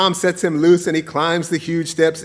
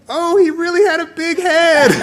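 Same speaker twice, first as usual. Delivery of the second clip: gleeful; 6 dB louder than usual. Real, not acted. Punchline delivered laughing.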